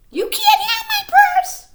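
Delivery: very high, loud squeaky Pearl; Pearl defiant. A narrator's reading. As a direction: squeal it out with a laugh. Pearl refuses robbers.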